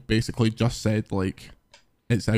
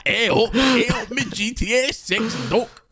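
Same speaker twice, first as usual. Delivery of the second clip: hey up, much higher, laughing, slow; mock Yorkshire; 10 semitones higher than usual. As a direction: ham up a Yorkshire greeting. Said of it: "Doctor Who talks like this".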